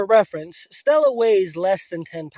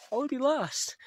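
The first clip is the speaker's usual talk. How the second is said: higher, softer, voice close to breaking; emotional; 6 semitones higher, 7 dB quieter than usual. Real, not acted. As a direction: repeat it as emotion rises. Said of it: "Sorry, I got all emotional".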